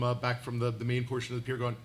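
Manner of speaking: ordinary talk, nothing marked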